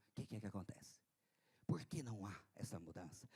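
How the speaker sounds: whispered rhetorical question; hushed, searching whisper. Acted. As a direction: breathe the question softly to the congregation. Preacher drops to searching question.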